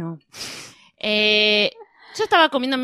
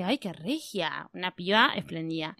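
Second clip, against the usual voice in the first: admiring aside; 5 semitones lower, 12 dB quieter, slower than usual. Retold or imitated. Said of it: "y dije".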